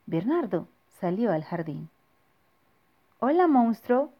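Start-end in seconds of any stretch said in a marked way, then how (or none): 3.2-4.1 put-on cheerful child voice. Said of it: "le dijo al monstruo".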